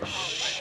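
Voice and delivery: soft unvoiced shh; shushing. Real, not acted. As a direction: hush the room. Quieting a crowd of students.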